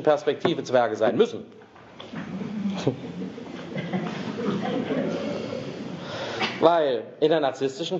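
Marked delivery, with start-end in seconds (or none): 2.0-6.6 soft airy laugh at her own point; breathy laugh. Real, not acted. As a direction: laugh quietly, almost silent. Speaker laughs at own joke.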